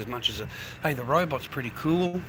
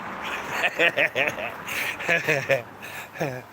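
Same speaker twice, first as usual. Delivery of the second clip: laughing; 2 semitones lower, 3 dB quieter than usual. Real, not acted. Streamer cracking up after warning the viewer, chuckling. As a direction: chuckle trailing off in short bursts.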